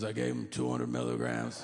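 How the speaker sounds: very low, dopey admission; low stoner confession; deep slacker voice. Retold or imitated. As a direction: drop way down, unbothered. Joey admits dose.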